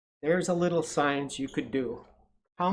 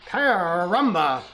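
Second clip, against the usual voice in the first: exclaiming; 3 semitones higher, 4 dB louder, much slower than usual. A narrator's reading. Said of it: "cried the duck".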